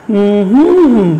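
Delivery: low closed-mouth hum with a rise and fall in the middle; hummed stress pattern. Shown as a demonstration. Teacher shows students the stress of umbrella, hummed. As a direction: hum the melody of a three-syllable word, middle stressed. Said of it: "আমার সাথে করতে পারো এভাবে".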